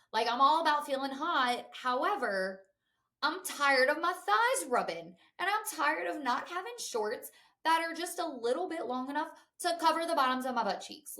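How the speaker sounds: fired up over chafing thighs; worked-up rant. Retold or imitated. To her partner, defending the shorts, getting worked up. Ranting about shorts, voice rising.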